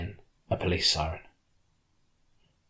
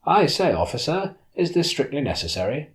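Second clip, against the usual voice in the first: unconvincingly posh voice; 7 semitones higher, 4 dB louder than usual. Acted. A tourist fakes posh English to the searching officer. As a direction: put on a hammy upper-class English accent. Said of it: "in an unconvincingly posh voice".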